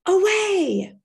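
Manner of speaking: high, loud shout to the horses; yelled command. Acted. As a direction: crack it out like the whip. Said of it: "cracked her whip and yelled".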